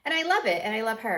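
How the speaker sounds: bursting out with love; gushing. Real, not acted. Proud mother gushing.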